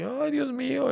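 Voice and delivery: high-pitched wail of a weeping lion; mock crying. Narrator shows the lion crying in the net, mock wail. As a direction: imitate crying with a high, drawn-out whine. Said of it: "empezaba a llorar así".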